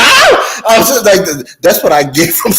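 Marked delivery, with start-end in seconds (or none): 0.0-0.6 high, loud mock shriek of fright; imitated scream. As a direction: shriek like waking to a horror. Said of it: "I'm like".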